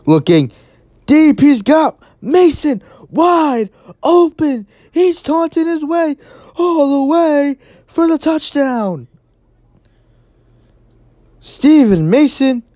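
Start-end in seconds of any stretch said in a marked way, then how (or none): 1.1-12.6 excited call. Real, not acted. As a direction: let the long bomb build to the score. Long touchdown pass called.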